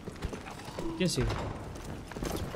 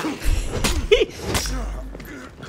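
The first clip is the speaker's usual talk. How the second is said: gleeful laugh; 10 semitones higher than usual. Real, not acted. After landing a hit.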